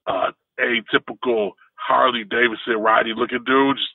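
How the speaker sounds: ordinary talk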